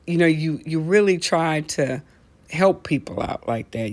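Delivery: normal talking voice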